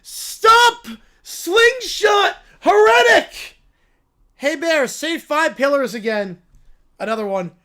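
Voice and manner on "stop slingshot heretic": loud, anguished preacher's cry; a wounded zealot shouting at the sky; outraged preacher. Acted. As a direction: howl it like your church just fell. Character yelling.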